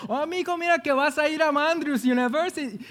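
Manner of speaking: high-pitched Spanish-speaking grandma; grandma's Spanish accent. Acted. Grandma phoning her grandson about his trip, Spanish accent. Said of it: "she called me and said".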